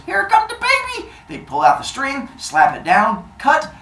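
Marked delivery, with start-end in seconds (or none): 0.1-1.1 high, bright announcement of the birth; Mama Stabby cheering as baby arrives; excited mom. Retold or imitated. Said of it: "Mama Stabby goes".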